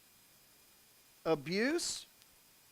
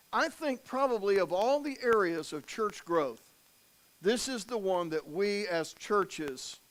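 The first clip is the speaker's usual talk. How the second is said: usual speaking voice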